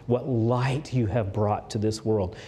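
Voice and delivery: ordinary delivery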